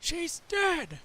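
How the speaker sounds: high, loud horrified outburst; shocked cry. Acted. Discovering the body, a high loud horrified cry. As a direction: blurt it out in sudden horror.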